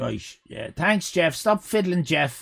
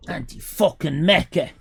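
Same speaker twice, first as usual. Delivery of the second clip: ordinary talk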